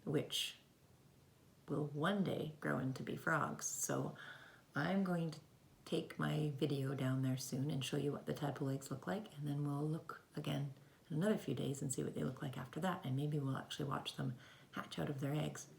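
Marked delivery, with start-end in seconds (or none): none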